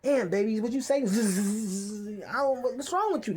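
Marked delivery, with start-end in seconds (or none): 1.0-2.2 fly buzz. Retold or imitated.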